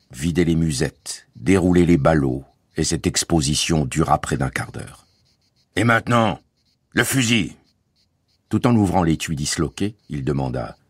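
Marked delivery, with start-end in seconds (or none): 5.8-7.6 raised, peremptory voice; curt demand. A narrator's reading. Guard demands to see the gun, peremptory. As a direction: order it with official menace.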